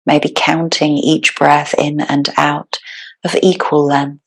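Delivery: calm, unhurried meditation guide with long pauses; guided meditation. Real, not acted. Yoga teacher settling the class into breathwork, guided meditation. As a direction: ease them into stillness, phrase by phrase.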